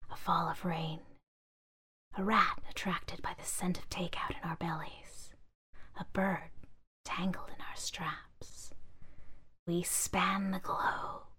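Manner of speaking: soft whispering chorus; whispering bags. Acted. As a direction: whisper the list, slow. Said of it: "its companions agreed".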